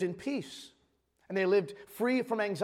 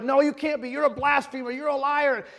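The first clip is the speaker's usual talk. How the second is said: mock accusing; 6 semitones higher, 5 dB louder than usual. Crowd rejecting Jesus.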